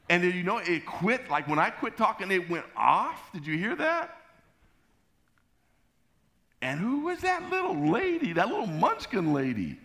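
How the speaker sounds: hammed-up high indignant complaint; exasperated rant. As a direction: rant in disbelief with the pitch way up. Acting out his exasperated rant afterwards.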